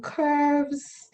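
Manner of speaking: ordinary talk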